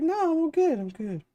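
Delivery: sloshed buddy waving it off, pitched high; drunk friend. Retold or imitated. Drunk friend voiced in story.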